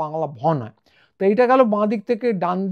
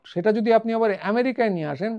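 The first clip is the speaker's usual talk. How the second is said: ordinary talk, nothing marked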